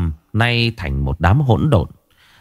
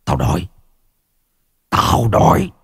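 growling; 3 semitones lower than usual. A narrator's reading. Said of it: "miệng lão cứ gầm gừ".

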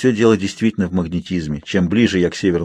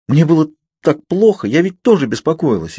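anxious confession; 7 semitones higher than usual. A narrator's reading. Heroine confesses her worry.

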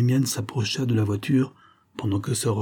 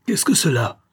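sharp, higher angry outburst; furious cry; 8 semitones higher, 4 dB louder than usual. A narrator's reading. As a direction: snap the question out in fury. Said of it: "s'écria Sir Rupert avec fureur".

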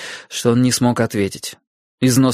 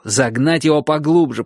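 plain reading voice

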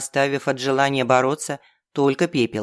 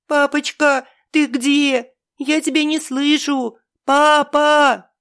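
put-on high, choking sobs of a child; snotty crying; 11 semitones higher, 4 dB louder, slower than usual. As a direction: sob it out like a frantic child. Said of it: "рыдал в голос, захлёбываясь от отчаяния".

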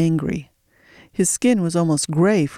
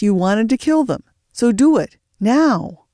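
higher, louder pushing command; urging; 6 semitones higher, 4 dB louder than usual. A narrator's reading. The boss pushes C J to kill them, higher and louder. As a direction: goad him into it.

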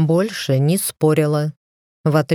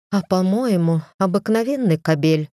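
plain reading voice

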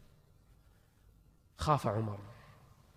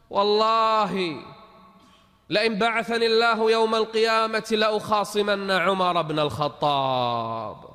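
slow, drawn-out, louder oath; old woman's oath; 4 semitones higher, 5 dB louder, slower than usual. Acted. Old woman vows to sue Umar on Judgment Day, slow and loud. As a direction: stretch the vow out like a grieving old woman.

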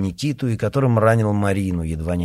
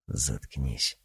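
low, soft warning; quiet; 9 semitones lower, 10 dB quieter than usual. Acted. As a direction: hiss the order under your breath. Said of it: "тихо сказал Форкис".

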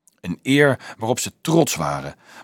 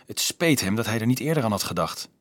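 ordinary delivery, nothing marked

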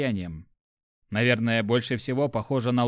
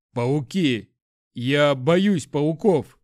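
loud, slow frightened confession; breathless fear; 6 dB louder, much slower than usual. A narrator's reading. Lad confesses fear of spiders, breathless fear. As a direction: force the words out in fear. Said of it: "задыхаясь от страха, прошептал парень".

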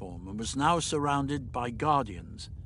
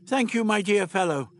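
higher, lilting thanks; genial old Doctor; 8 semitones higher, 3 dB louder than usual. Acted. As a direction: thank them like a kindly eccentric.

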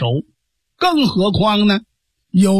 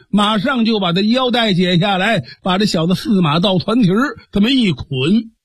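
plain reading voice

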